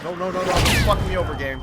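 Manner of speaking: high, loud panicked pleading; panicked yelling. Real, not acted. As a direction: yell it in sheer panic. Panicking as enemy attacks.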